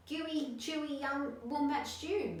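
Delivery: boastful dingo. A narrator's reading. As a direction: show off the stew proudly. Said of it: "boasted Dingo".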